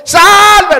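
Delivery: high, sudden yell for help; acted desperate cry. Pedro cries to be saved as he sinks, urgent yell. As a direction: cry out as if going under. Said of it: "una oración como la que hizo Pedro".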